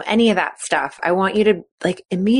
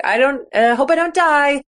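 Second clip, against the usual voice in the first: mock nervous athlete; 7 semitones higher than usual. Mock athlete fearful thought.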